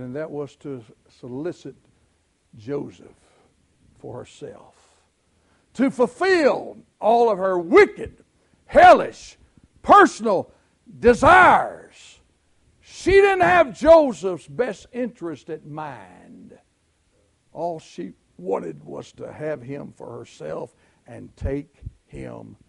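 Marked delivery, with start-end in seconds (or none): 5.7-16.6 slow, loud, higher cry against wickedness; preacher denouncing Potiphar's wife; fiery preaching. Acted. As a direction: hammer each word of condemnation. Preaching at full force.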